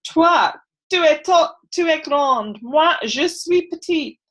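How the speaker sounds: playful classroom French in a French accent. Shown as a demonstration. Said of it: "that is my worst French, best accent".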